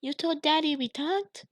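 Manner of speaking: put-on high frightened child's gasp; little sister panicking; panicked. A narrator's reading. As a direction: ask in sudden panic. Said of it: "in a panicked voice".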